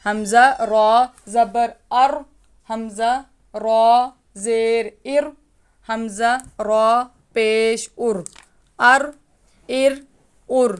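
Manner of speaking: ار heavy with زبر and پیش, thin with زیر; teacher contrasting a heavy and a thin ر in ار. Shown as a demonstration. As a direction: switch the ر from full to thin by vowel. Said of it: "باریک پڑھنا ہے ... موٹا پڑھنا ہے".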